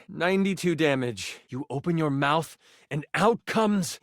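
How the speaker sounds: ordinary talk, nothing marked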